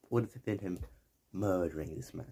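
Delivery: normal talking voice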